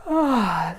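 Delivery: loud, high "ah" falling away; big open yawn. Shown as a demonstration. Yoga teacher demonstrating a settling yawn, big and open. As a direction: let out a big audible yawn.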